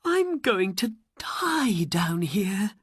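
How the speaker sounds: slow, breathless whisper of despair; hopeless whisper. Acted. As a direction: whisper it like someone giving up hope in the dark. Aladdin trapped, losing hope.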